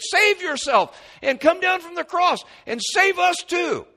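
very high, loud sneering voice; a crucified thief scoffing at his neighbour; mocking thief. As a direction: jeer like a dying thief taunting Jesus. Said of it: "One of the thieves said that to him".

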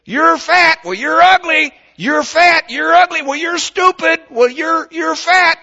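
put-on be a child hurling name-calling at a sibling; kids trading insults. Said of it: "one of them says".

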